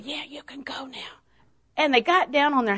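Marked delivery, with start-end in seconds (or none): 0.0-1.2 slow, very soft whispered permission; mother's whisper. Retold or imitated. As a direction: whisper back to the child. Mother whispers back in story.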